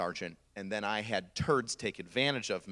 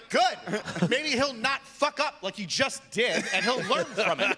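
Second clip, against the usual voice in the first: high, emphatic mock rant; drawn-out, raised-pitch sergeant retort; callous drill sergeant; 11 semitones higher, slower than usual. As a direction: drive each word home unsympathetically. Sergeant's acted retort about crying recruit.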